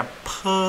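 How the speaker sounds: "pe" with a puff of air after the P; aspirated P. Shown as a demonstration. Teacher showing learners how P is read, aspirated "pe". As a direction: sound out "pe" with strong breath pressure. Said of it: "mereka ada tekanan udaranya".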